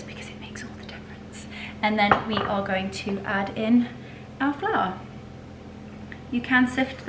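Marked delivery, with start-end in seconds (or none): 0.0-1.5 whisper. Real, not acted. Whispered tip to viewers.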